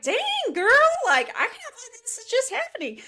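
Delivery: very high, retold squeal of amazement; giddy disbelief. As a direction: squeal to yourself in mock-swooning disbelief. Retells giddy reaction to kiss.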